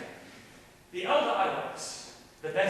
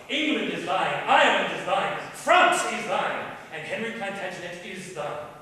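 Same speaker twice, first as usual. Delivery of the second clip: building proclamation; 8 dB louder than usual. Acted. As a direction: proclaim each realm as a gift. King lists his kingdoms to Kate.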